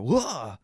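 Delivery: high falling "whoa!"; playful peekaboo whoa. Retold or imitated. Parent surprising baby under the table, playful high whoop. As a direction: pop out with a playful cry at a baby.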